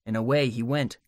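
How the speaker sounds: plain reading voice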